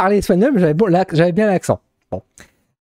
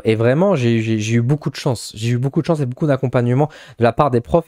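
normal talking voice

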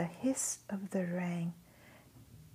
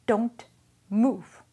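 loud, higher, hard command; ordered; 4 semitones higher, 10 dB louder than usual. Acted. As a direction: bark it down at the child.

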